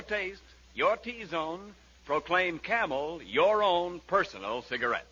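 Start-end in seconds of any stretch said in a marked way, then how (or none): none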